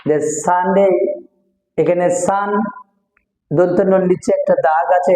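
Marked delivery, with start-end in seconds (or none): none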